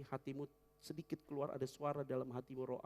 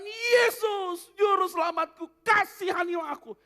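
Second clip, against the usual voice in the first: high, loud sobbing cry; crying shout; 17 dB louder, slower than usual. Acted. Kneeling blind man crying to Jesus, shouting and sobbing. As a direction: wail for mercy through tears. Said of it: "berteriak sambil menangis".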